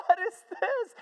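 high, loud overwhelmed female voice; imitated tearful amazement. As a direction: play her in fresh tears at the sight. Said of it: "more tears flow".

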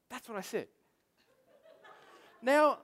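ordinary delivery

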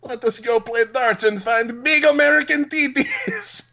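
high, slow, hammed-up Russian voice; mock Russian accent. Russian cousin invites him to play darts, mock accent. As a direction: draw it out in a cartoon Russian accent.

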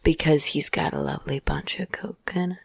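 soft whispered aside; whispering. Acted. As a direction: breathe it, no voice.

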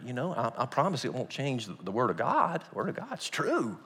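usual speaking voice

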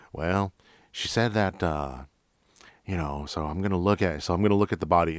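lightly voiced old Texas sergeant accent. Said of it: "I'm not gonna keep doing the accent".

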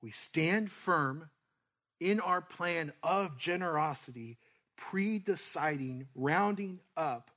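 slow, emphatic exhortation; preaching. Real, not acted. Pastor urging the church to give, slow and firm. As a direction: stress each step like a charge.

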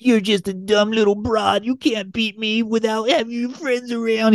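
high, mocking sore-loser voice; sneering villain.

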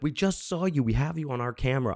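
retold accusing yell, high but held back; mock screaming. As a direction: pitch it up like yelling, without the volume. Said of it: "I'm screaming at this guy".

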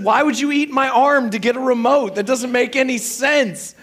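high, loud retold protest; exasperated wife. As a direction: imitate a wife fed up with nonsense. Acted quarrel, wife's side.

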